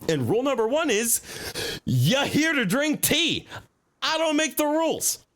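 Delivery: high, huffy shopkeeper voice, indignant; high-voiced tea shop owner. Tea shop owner fuming about customers, high indignant voice. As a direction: play the outraged tea lady.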